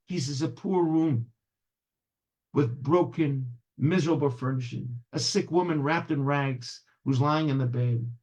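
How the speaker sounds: ordinary delivery, nothing marked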